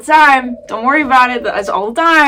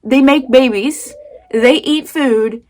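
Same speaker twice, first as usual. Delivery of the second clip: ordinary talk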